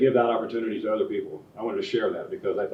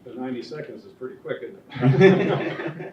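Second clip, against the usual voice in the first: laughing; 3 semitones higher, 6 dB louder, slower than usual. Real, not acted. Joking about time limit, laughing.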